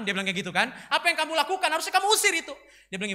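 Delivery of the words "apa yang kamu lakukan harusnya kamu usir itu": high, loud outburst; scolding teacher. Acted. Drama teacher berates Brian after the play, shouting. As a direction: berate the pupil in a raised voice.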